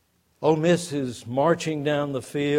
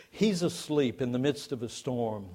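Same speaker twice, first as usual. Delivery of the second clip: ordinary talk, nothing marked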